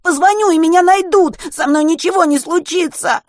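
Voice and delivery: frantic begging; desperate pleading. A narrator's reading. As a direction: beg through panic. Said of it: "умолял Веня".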